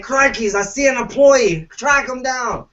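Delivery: high, excited mock Australian hunter voice; hammed-up Australian wildlife host; Steve Irwin. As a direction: hunt the employee like a croc in a mock Aussie accent. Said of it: "be like Steve Irwin and be like".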